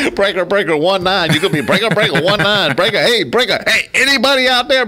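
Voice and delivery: high, loud trucker radio hail, breaker breaker; calling out over the airwaves to nobody; mock CB radio call. Host mocking the empty CB channel with a loud radio hail.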